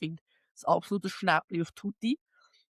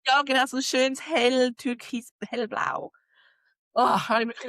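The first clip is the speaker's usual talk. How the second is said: ordinary delivery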